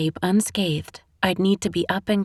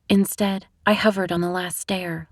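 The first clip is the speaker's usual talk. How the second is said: ordinary delivery, nothing marked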